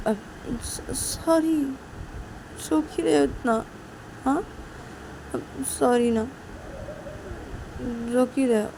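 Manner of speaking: high, soft coaxing; coaxing apology. Acted. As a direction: gently plead with her to stop crying. Siya coaxing crying Shruti.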